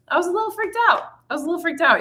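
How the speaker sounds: very high, squeaky comic panic; mock freak-out. Singer admitting fear before big show, high mock panic. Singer recalls losing her voice.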